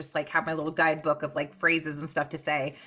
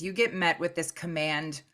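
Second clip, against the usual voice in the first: usual speaking voice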